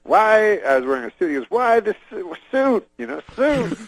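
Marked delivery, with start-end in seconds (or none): 0.0-0.6 high, loud one-word question; Bill Cosby impression. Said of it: "he talks, like, just in little". 1.5-3.8 high-pitched, fragmented questioning; Bill Cosby impression. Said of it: "he doesn't use full sentences".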